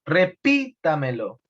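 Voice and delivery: "repítamelo" said slowly with a strong "pí"; slow teaching demo of "repítamelo", weight on "pí"; stress on PÍ. Shown as a demonstration. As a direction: punch the "pí" in "repítamelo", then let the ending fall. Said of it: "Y en esta sobresdrújula".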